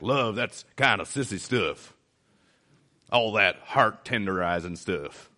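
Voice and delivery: mock tough guy with a Southern drawl; Southern accent. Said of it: "I did a Southern accent".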